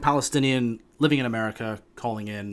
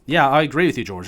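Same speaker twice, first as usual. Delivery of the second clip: normal talking voice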